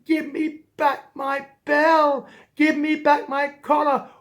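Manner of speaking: hammed-up bawling cat demanding it back; crying. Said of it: "started crying".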